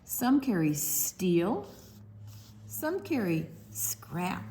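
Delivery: rhythmic list, rising on each item; reading to children. Acted. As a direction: build the rhyme item by item. Reads rhyming list aloud.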